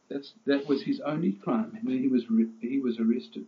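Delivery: normal talking voice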